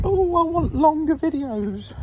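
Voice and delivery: high, slow whiny mimicry; mocking viewers. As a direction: whine like a demanding viewer. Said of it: "you're like".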